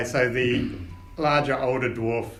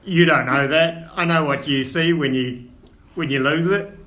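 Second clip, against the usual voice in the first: Cockney; 4 semitones higher, 6 dB louder than usual. Acted. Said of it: "a Cockney accent".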